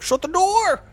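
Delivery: high, loud yell; hammed-up holler across a room; mock shout. Yelling at family about the sealed room, mock shout.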